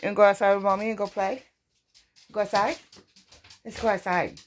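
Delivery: high, quick cooing invitation; coaxing pet talk. Real, not acted. Mom inviting her dog to play outside, high coaxing.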